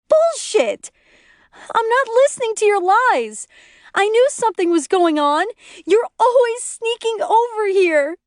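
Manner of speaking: very high, angry wail; screaming and bawling. A narrator's reading. Lori refusing to listen to lies, high bawling scream. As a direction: shriek accusations as if bawling. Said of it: "covering her ears and bawling".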